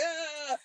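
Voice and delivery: high, soft mock cackle; imitated laughter. Mobsters laughing at his story, high imitated laugh. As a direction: mimic old pals cracking up, high-pitched. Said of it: "they're all laughing".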